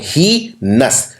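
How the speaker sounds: ही नस drawn out very slowly, syllable by syllable; slow spelling syllables. Shown as a demonstration. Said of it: "और फिर लगता है नस".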